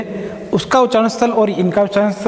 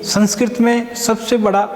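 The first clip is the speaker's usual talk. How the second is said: usual speaking voice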